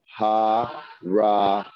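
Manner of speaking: Ha-ra-ma split into slow separate syllables. Shown as a demonstration. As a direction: sound out ha, ra, ma slowly.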